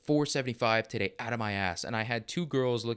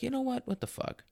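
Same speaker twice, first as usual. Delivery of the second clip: exasperated; 12 semitones higher, 4 dB quieter than usual. Retold or imitated. Self-quote in frustration.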